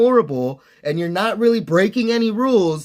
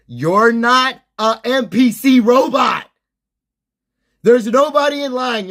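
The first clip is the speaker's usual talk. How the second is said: angry outburst; 4 semitones higher, 4 dB louder than usual. Real, not acted. Ranting at rude drive-thru kid.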